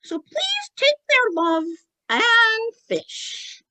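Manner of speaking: very high ventriloquist falsetto; high-pitched put-on spirit voice; childlike spirit sign-off. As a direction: deliver a message of love in a squeaky puppet voice. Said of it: "And then he's like".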